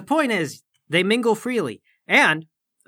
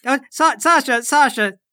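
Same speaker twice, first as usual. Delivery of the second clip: calling after her; 4 semitones higher, 5 dB louder, slower than usual. Real, not acted.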